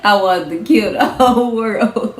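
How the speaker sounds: loud laugh through the words; laughing while saying it. Real, not acted. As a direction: laugh at your own fury. Laughing about her rage.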